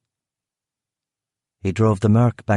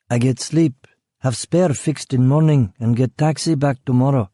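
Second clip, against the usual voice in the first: put-on higher, broken-English Eastern European accent; vague Eastern European accent; 4 semitones higher than usual. Killer keeps up his foreign act with the guard, vague Eastern European accent. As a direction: play the clueless foreign driver.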